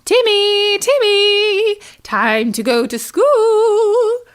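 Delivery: mother frog calling her son. Acted. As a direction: call your child home across the pond. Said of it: "called out".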